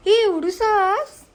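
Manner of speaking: high, loud, drawn-out disbelief; exaggerated disbelief. Real, not acted. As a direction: stretch it out, mock shocked. Tiny family pack mocked.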